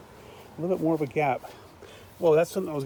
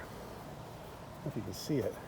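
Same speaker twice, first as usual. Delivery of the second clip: whispered aside; 3 semitones lower, 12 dB quieter than usual. Real, not acted. Aside to viewer.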